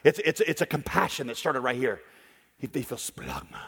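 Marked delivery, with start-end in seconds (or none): 2.6-3.6 splagma much lower and softer, from the gut; gut-level splagma. Shown as a demonstration. Preacher voicing the lender feeling splagma, low and soft. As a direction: sink the voice down into splagma. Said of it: "supposed to sound like".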